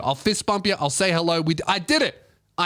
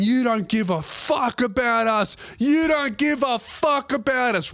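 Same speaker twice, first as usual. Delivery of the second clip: put-on high, slow slurred drunk whine; mock an angry drunk lad; drunk angry accusation; 6 semitones higher than usual. Drunk fan follows them to the station, slow angry slur. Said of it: "Real drunk, real angry".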